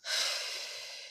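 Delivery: long quiet breathy sigh of exhaustion; heavy sigh before going on; weary sigh. Real, not acted. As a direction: let out a tired sigh. Venting about losing homes.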